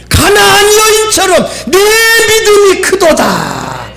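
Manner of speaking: impassioned sermon climax; full-force preaching. Real, not acted. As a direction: urge the congregation fervently. Exhorts congregation to pray.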